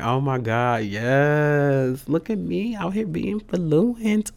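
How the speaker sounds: high, slow, giddy boast; gleeful bragging. Retold or imitated. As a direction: show off, thrilled with yourself. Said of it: "I was like".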